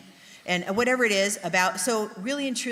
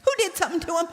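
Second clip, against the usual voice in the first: high-pitched, louder protective alarm; alarmed inner thought; 10 semitones higher, 5 dB louder, much faster than usual. Retold or imitated. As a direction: act out a worried mother's silent panic aloud. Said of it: "That's what I'm thinking in my head".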